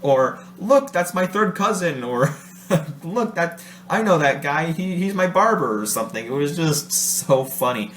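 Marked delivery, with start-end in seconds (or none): 0.6-2.0 high-pitched imitation of a jokey hail; playful mock call-out, pitched up. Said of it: "He would call out to different people". 3.0-5.8 imitating a joker hailing a stranger; high-pitched playful mock call-out. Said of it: "He would call out to different people".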